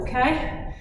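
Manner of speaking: normal talking voice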